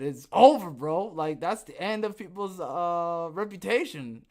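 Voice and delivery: loud burst on "It's over"; hammed-up doom; mock exclaimed verdict. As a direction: declare it done, half laughing. Retold own exclaimed reply.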